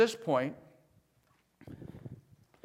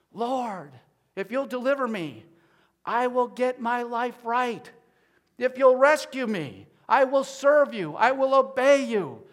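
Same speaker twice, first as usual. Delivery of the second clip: high, pleading promise to God, building louder; bargaining prayer; 6 semitones higher, 7 dB louder than usual. Acted. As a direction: bargain desperately, louder with each vow. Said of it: "they're kind of like that show".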